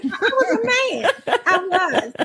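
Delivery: high laughing admission; real giggling through the confession. Real, not acted.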